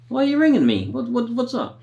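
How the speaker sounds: high-pitched, baffled retold questions; puzzled questioning. Neighbour asks why he is being rung, high and puzzled. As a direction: act out a neighbour wondering at the call.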